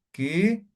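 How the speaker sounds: "Ki" with the i held long; long vowel. Shown as a demonstration. Teacher repeating the syllable, "Ki" lengthened. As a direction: draw out the vowel of "Ki". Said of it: "Aquí alargo la vocal".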